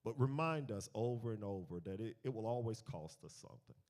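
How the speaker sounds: earnest spoken prayer; closing prayer after the sermon, earnest. Acted. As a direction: pray for the group.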